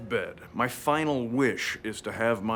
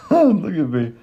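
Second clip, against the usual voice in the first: higher, louder, through a laugh; amused laugh; 5 semitones higher, 7 dB louder than usual. Acted. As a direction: laugh as you point yourself out. Laughing at his own old photo.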